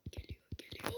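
tense whisper. Real, not acted. Gamer whispering that the enemy is coming. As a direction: hush it as something approaches. Voiceless aside.

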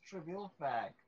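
normal talking voice

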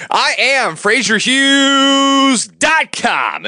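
slow, high announcer intro; big radio announcer voice. Acted.

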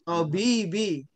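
slow "tobibi" with a drawn-out middle vowel; measured teacher's demo of "tobibi"; model Arabic reading. Shown as a demonstration. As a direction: stretch the "bi" in "tobibi". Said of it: "Lamnya jangan dibaca".

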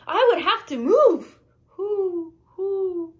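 high owl voice ending in long hoots; stretched, high-pitched storybook owl; hooting owl. A narrator's reading. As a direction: hoot it out like an owl. Owl speaks, then hoots.

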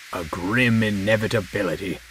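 ordinary delivery

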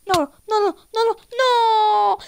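rapid noes building to a long loud cry; mounting protest. Real, not acted. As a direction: build from worry to a yell. Danger mounts during the game.

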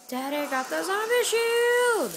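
high, drawn-out sing-song boast; gleeful crowing. Real, not acted. Announcing Daddy's new zombie shield, gleeful crowing. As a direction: crow it slowly, stretching the last word high.

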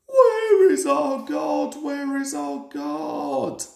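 two coaches calling out in alarm; crying out. A narrator's reading. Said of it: "they cried".

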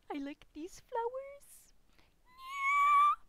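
drawn-out squeaky high voice, rising at the end; silly high squeak swelling to a yell; high-pitched squeal. Acted. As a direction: squeal it slowly, way up high, ending in a loud "yeah". Same words repeated in a put-on squeal.

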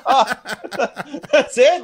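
high laughing disbelief; amused, stunned laugh. Real, not acted. Laughing at the insult.

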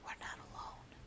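hushed, barely voiced; husband warning wife in the dark; quiet. A narrator's reading. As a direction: whisper it so nobody hears. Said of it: "I said quietly".

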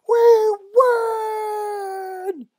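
high, loud squealing cheer, stretched out; victory cheer. Acted. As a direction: shriek in triumph like a group of girls. Girls cheer their victory.

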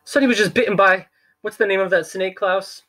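high, frantic question; flustered banker. Acted. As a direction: grope for the snake's name in a panic. Poe flustered asking Klaus.

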